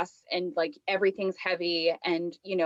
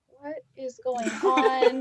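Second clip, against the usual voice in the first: put-on slow, higher, louder puzzled kids; bewildered kids; 5 semitones higher, 4 dB louder, much slower than usual. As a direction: drag it out baffled. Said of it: "the kids were just like".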